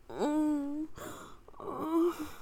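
usual speaking voice

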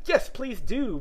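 high, entreating plea; pleading. A narrator's reading. Alice begging the Dormouse for a story, pleading. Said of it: "pleaded Alice".

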